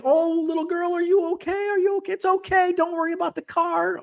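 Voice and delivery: very high, fretful old-man impression; high-pitched imitation of a concerned old man; little old man. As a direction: fuss gently in a high put-on voice. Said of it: "Little old man's like".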